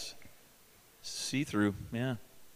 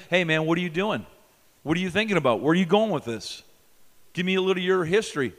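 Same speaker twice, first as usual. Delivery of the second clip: put-on loud, grilling the boyfriend; stern interrogation; 2 semitones higher, 6 dB louder, faster than usual. Preacher grills a girl's date, loud and stern. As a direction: demand answers from the suitor. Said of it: "You call this preacher".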